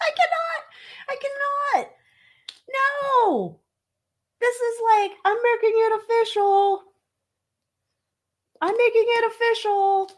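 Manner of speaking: very high, held falsetto; mock-overwhelmed squeal. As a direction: squeak it out in a tiny, sky-high voice. Mock-overwhelmed squealed reaction to reveal.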